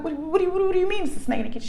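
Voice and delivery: high, rapid, stammering question; retold flustered demand for an explanation; startled disbelief. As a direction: blurt it out fast and high in disbelief. Mother retelling her startled reaction.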